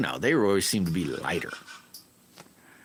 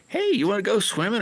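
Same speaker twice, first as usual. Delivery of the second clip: high mock friend voice; chirpy high imitation of a buddy; friends calling; 4 dB louder, faster than usual. Friend inviting him swimming, high mock voice.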